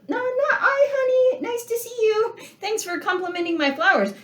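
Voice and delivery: high, sugary loving-wife voice; sweet wife voice. Pretend. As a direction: play the doting wife, all sweetness.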